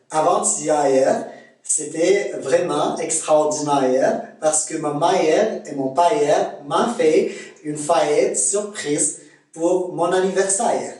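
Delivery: Québécois accent, deliberately gliding vowels; imitating Québécois accent. Shown as a demonstration. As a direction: demonstrate a Canadian French, Québécois accent. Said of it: "on va essayer de imiter les Canadiens".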